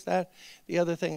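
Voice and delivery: usual speaking voice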